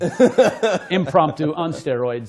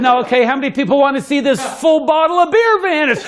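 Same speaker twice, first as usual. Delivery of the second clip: showman call; 9 semitones higher, 5 dB louder than usual. Retold or imitated. Showman calling to bar crowd.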